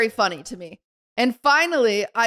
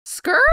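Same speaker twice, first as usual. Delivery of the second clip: imitated tyre screech; 8 semitones higher than usual. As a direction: screech like a car peeling away. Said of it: "And then I just go".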